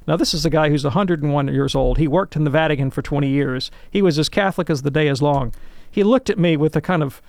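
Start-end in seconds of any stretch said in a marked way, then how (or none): none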